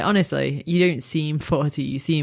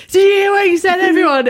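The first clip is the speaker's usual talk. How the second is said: much higher, loud mock shout to a crowd; 8 dB louder than usual. Imagining her cohost shouting at the audience in a mock shout. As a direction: yell it at the whole room. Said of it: "and then going".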